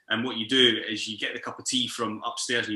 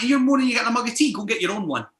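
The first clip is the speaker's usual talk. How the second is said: indignant retort; 10 semitones higher than usual. Retold or imitated. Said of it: "I'm like".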